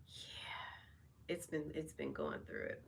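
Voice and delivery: near-whispered weary confession; soft spent whisper about a hard time. Real, not acted. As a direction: drop to a breathy hush, almost to yourself. Confessing a rough stretch.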